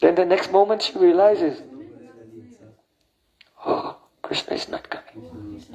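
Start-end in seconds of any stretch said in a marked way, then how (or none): 3.6-5.6 whispered, softer, much lower; sorrowful whisper. Acted. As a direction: breathe it out as hope collapses. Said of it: "And she's crying".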